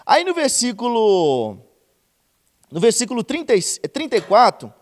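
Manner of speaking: loud, drawn-out pulpit delivery; loud preacher announcing the verse; preaching at full force. Acted. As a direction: hold the pulpit voice while finding the verse.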